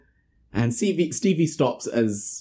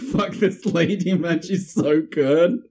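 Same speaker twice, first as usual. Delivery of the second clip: delighted; 5 semitones higher, slower than usual. Retold or imitated. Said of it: "I'm like".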